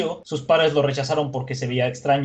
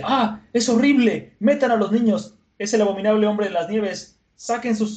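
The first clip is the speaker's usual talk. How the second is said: high-pitched mock panic; hammed-up horrified warning; acted alarm; 8 semitones higher than usual. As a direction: cry out like an alarmed villager. Acts out panicking villagers seeing monster.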